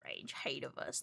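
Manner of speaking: mock whispered accusation; whispered playful grudge. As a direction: breathe it out, voiceless.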